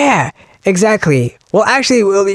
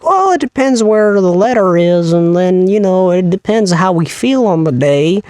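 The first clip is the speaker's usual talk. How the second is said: mock indecision. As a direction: play English as vague and whimsical. Mocking English's inconsistent rules.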